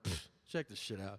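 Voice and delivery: low, cocky dismissive scoff; arrogant. Retold or imitated. Cocky patient showing off at the eye test, arrogant. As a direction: brush it off with smug confidence. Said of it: "I was still arrogant about it".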